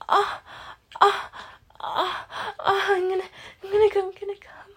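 high, loud, breathless pleading; urgent begging. Acted. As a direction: gasp and beg as it peaks. Performed climax, begging a partner.

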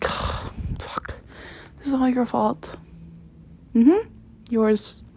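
normal talking voice

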